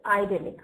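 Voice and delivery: IDYLLIC with "eye" at the start; US pronunciation. Shown as a demonstration. Teacher confirming the US English form, IDYLLIC with long i. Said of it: "So in U S English".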